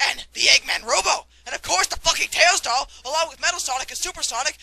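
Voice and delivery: ordinary talk, nothing marked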